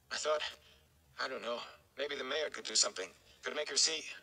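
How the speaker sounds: a lightly voiced young man, anxious and pleading; anxious young Rand. Rand pleads about the mayor.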